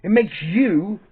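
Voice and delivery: rising angry rant; heated rant. Real, not acted. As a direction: vent the anger, pitch up. Angry rant about pandemic.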